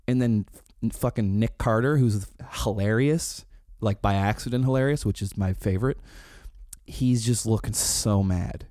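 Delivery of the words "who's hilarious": ordinary talk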